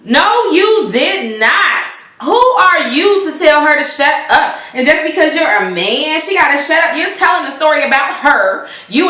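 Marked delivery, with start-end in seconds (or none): 0.0-4.1 slow, high indignant no you did not; sassy indignant retort, slowed; outraged no. Real, not acted. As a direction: draw it out in disbelief. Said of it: "outraged reaction".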